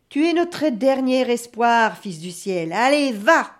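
loud, slow invocation ending in a command; impassioned send-off. Acted. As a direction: urge it onward with force. Chief launches his last raven.

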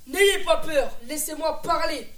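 loud projected plea, pitch up a little; loud stage plea. Acted. Young actor as Folko calming the crowd, loudly projected. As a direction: project it to the back row, urging calm. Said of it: "Je suis Folko".

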